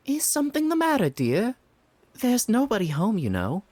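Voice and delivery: put-on high, chatty old lady voice; fussing neighbour, sweet and nosy; gossipy old woman.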